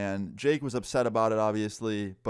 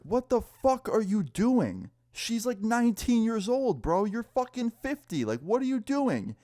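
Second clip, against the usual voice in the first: much higher, raised voice in disbelief; outraged confronting; 12 semitones higher than usual. Retold or imitated. Confronting Jake's dad about the nineteen-year-old, outraged and high. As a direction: call him out, incredulous and heated.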